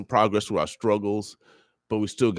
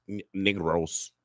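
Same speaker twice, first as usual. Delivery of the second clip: slow, softer word in a put-on Spanish accent; 5 semitones lower, 5 dB quieter, slower than usual. Shown as a demonstration. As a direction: draw it out with a mock Spanish accent. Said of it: "in my Spanish voice".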